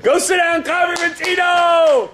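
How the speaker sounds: high, stretched announcer-like call. Real, not acted. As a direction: sing out the names high. Announcing the child back to seat.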